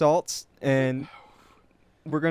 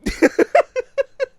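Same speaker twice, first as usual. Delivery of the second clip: high wheezing laugh; pained laughter; 8 dB louder than usual. Real, not acted. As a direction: laugh through the sting. Laughing after the sting.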